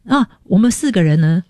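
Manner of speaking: ordinary delivery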